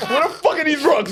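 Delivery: high, loud laughing yell; mock outraged yell over the sink; retold shout. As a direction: shout it through laughter at the rocks. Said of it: "I'm hearing him shout".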